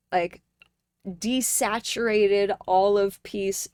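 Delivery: ordinary delivery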